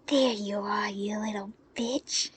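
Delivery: slow, breathy, threatening growl; a brute cornering his victim; menacing thug. Acted.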